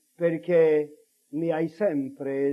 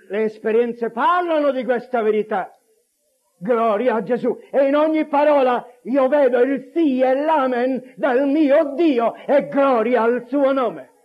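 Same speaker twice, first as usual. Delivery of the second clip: swelling, emphatic testimony; fervent preaching; 6 semitones higher, 7 dB louder than usual. Real, not acted. Preacher testifying that God's word is truth, fervent. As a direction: proclaim it with growing force.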